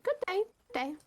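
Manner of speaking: bright high-pitched farewell; a chirpy passing townsman; cheery high greeting. Acted. GM voicing a departing man, high cheery greeting.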